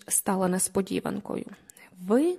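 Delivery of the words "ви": high-pitched startled cry, held in check; surprised exclamation. A narrator's reading. Two acquaintances meet unexpectedly by the hedge, surprised exclamation. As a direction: blurt it out in astonishment. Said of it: "в один голос вигукнули ми обоє".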